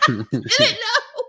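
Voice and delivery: high, slow, breaking into laughter; laughing. Real, not acted. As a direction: giggle the words out helplessly. Laughs, then repeats plainly.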